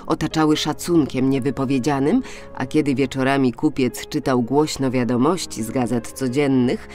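plain reading voice

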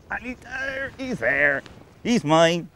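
high, loud whoop as the fish takes; excited shout. Real, not acted. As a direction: crow with delight at the hooked fish. Fish hooked, angler shouts excitedly.

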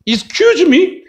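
high, uncomprehending double question; baffled American. Acted. American failing to understand Korean pronunciation, baffled. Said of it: "못 알아들어".

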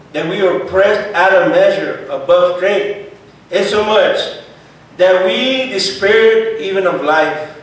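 loud, slow, high preacher's reading; forceful scripture reading. Acted. Preacher reading of trouble in Asia, forceful scripture reading. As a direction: proclaim each phrase loudly and deliberately.

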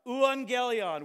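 loud, high herald's cry; messenger's shout. Acted. Messenger shouting victory to the city, loud herald cry. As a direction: call it out like a runner bringing victory news. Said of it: "the messengers would shout out".

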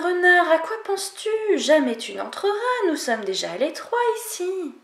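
small voices standing their ground; little animals refusing. Acted. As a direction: animals refusing the fox entry. Animals answer, then refuse fox.

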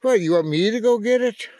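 loud, high-pitched teasing question; high, loud and incredulous; playful incredulity. Real, not acted. Mock questioning the pet.

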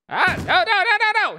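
very high, loud repeated no; loud high-pitched panic; panicked shout. Real, not acted. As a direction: yell in rising alarm. Attacked again in game.

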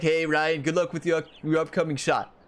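usual speaking voice

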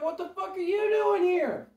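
high-pitched disbelieving wail; incredulous outcry. Acted. Monsters confront the six-foot rabbit, high incredulous outcry. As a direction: cry out in shrill disbelief, stretching it.

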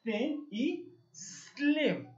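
slow, careful model of both words. Shown as a demonstration. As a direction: say each word slowly for the kids. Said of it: "Son muy parecidas". (despacio, modelando las palabras)

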